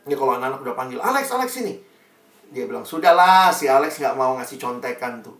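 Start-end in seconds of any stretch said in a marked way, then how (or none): 1.0-1.8 higher, a hint of calling out across the room; calling classmates. Classmates summoning Alex before the exam, light call. 2.8-5.4 louder start, brushing-off retort; dismissive friend. Retold or imitated. Andre telling classmates to give up, mild dismissive retort.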